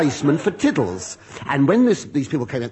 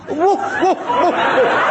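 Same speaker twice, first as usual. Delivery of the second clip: high, plummy barking woof; lightly voiced posh barking; posh dog bark; 13 semitones higher, 4 dB louder, slower than usual. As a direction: bark like a BBC announcer dog. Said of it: "barked with a B B C accent".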